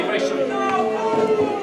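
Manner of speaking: slow high stretched apology; high drawn-out apology. Acted. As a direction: draw the sorry out high.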